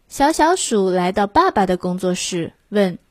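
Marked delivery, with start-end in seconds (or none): none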